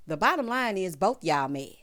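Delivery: ordinary delivery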